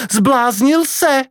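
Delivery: high, drawn-out cry of disbelief; zvolání. Acted. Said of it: "vykřikla Kvidova matka". (zděšené, vysoké zvolání matky)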